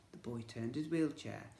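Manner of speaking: ordinary delivery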